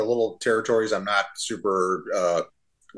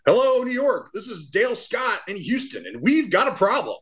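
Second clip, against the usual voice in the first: higher, mock-formal umpire voice over the headset; imitating Dale on headset; 12 semitones higher than usual. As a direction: play it like a hammed-up radio call to New York. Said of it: "Dale gets on the headset and he goes".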